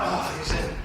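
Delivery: usual speaking voice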